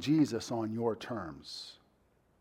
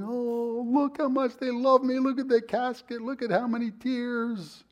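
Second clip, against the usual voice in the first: high, gushing voice of the departed looking down; a much higher, mocking voice of the dead admiring their own funeral; mock dead loved one; 11 semitones higher than usual. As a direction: play the flattered dead relative, pleased with the fuss. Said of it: "looking down somewhere going".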